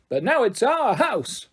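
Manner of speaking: high, slow, every word exclaimed; Emily leads Barry into the gym, proudly exclaiming; emphatic exclamation. Acted. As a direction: announce it proudly. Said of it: "end in an exclamation mark".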